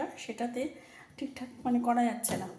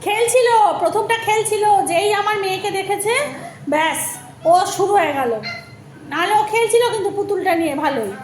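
raised voice over background noise; speaking loudly; 6 semitones higher, 8 dB louder than usual. Real, not acted. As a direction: push your voice over a barking dog. Talking over the barking dog.

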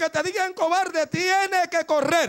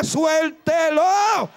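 commanding shout; much slower than usual. Retold or imitated. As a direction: order her to let go, stretching it out. Preacher commands mother release child.

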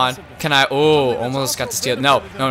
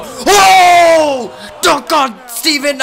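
much higher, loud commentary yell; excited announcer shout; 12 semitones higher, 7 dB louder, much slower than usual. Real, not acted. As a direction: scream the name like a buzzer call. Yelling live play-by-play.

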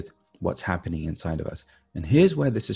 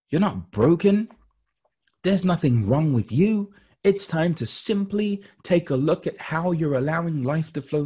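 high, emphatic, lilting oratory; motivational address; 9 semitones higher than usual. Acted. Guide welcoming newcomers to meditation, high motivational address. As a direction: lift each phrase like a rousing welcome.

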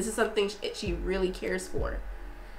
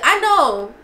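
loud, emphatic; loud mock reply; 5 semitones higher, 10 dB louder than usual. Fan answering the show loudly. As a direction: throw it out loud and proud. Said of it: "It's like".

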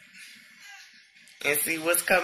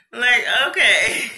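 laughing; 6 semitones higher, 8 dB louder than usual. Real, not acted. Laughing at the gifts.

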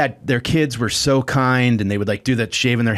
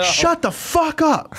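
slow, high mock yell with a laugh; slower than usual. Yelling at the neighbor's kids in the pool at night. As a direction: reenact snapping at the kids, laughing. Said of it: "I go".

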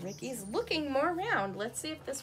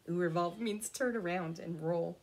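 plain reading voice

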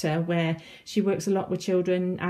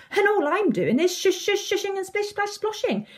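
high, playful, rhythmic sound words; eager demonstration; 13 semitones higher than usual. A narrator's reading. Sylvie showing Tom her sounds, eager and high. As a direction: show off the shushing and splashing.

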